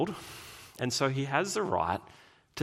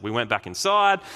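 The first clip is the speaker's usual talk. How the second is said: ordinary delivery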